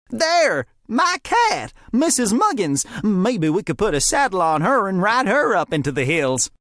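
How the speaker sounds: very high, loud proud announcement; excited deputy. A narrator's reading. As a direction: show off a silly idea with glee. Fred's proud idea.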